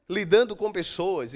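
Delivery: ordinary talk, nothing marked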